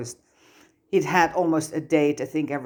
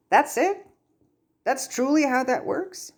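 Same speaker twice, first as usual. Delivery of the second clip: much higher, wide-eyed disbelief; astonished; 11 semitones higher than usual. Acted. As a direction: gasp out the question in amazement. Tom stunned by simple explanation.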